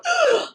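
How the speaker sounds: sharp high mock gasp; shocked gasp. Protester approached by comic, gasping in shock. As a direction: gasp as if appalled. Re-enacts reaction to being approached.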